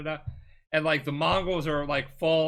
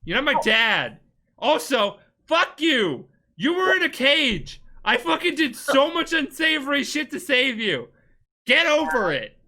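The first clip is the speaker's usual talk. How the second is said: higher, louder, shouted at the uncle; furious yelling; 10 semitones higher, 7 dB louder than usual. Retold or imitated. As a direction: yell it like a rebellious nephew. Player shouting at uncle in quarrel.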